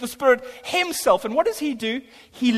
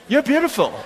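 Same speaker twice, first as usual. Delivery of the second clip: higher-pitched hammed-up compliment; mock pickup line; 4 semitones higher, 4 dB louder than usual. Preacher mocks his own words as a pickup line, cheesily. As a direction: play it as a cheesy chat-up. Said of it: "sounds like a pickup line".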